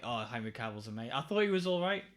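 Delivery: ordinary talk, nothing marked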